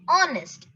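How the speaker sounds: "Honest" as on-est; silent H. Shown as a demonstration. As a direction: begin "Honest" on the vowel. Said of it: "You just pronounce O N E S T".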